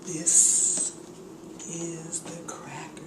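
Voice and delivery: unvoiced, soft reveal of the cracker; hushed reveal. Real, not acted. Host whispers as she reveals the cracker, hushed. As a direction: whisper it like a secret treasure.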